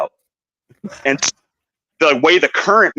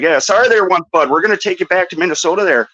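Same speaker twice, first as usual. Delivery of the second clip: higher-pitched mock Canadian accent; apologetic Canadian; 5 semitones higher than usual. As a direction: apologise as a folksy Canadian Mountie. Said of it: "like, apologetic".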